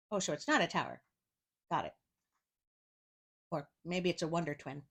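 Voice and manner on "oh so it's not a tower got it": plain reading voice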